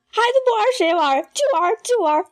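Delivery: high, defiant shriek of a stepmother. A narrator's reading. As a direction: shriek it high, shielding the boy. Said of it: "尖叫".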